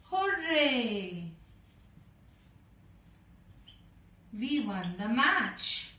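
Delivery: cheerful hooray, then slow proud words; happy cheer. Shown as a demonstration. Teacher acting a match win for children, cheering. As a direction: celebrate a win for the class. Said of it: "expressing our strong emotion of excitement and happiness".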